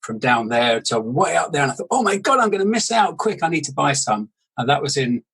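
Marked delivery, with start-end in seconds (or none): 1.9-4.3 high, hurried retold fear of missing out; mock panic. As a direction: play up your own past panic. Retells his panic about missing out.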